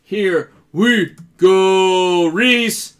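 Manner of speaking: slow, drawn-out hype; hyped announcer. Real, not acted. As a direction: stretch it out like a hype man.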